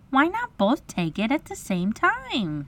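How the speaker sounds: put-on high little-girl voice; bright-idea girl. A narrator's reading. Wendy offers an idea, girlish voice. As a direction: suggest it as a helpful girl. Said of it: "Wendy had a splendid idea".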